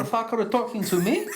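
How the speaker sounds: high, laughing put-on voice; Putin impression. Cohost voicing Putin scoffing, high and laughing. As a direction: sneer it through laughter. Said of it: "you're really good at impressions".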